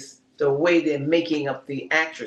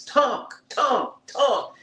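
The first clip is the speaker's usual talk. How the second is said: high, falling mock complaint on each word; three high, separate, sing-out jabs; retold exasperation; 9 semitones higher, much slower than usual. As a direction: mimic someone fed up with chatter, pitched up. Retold exasperation at mother's chatter.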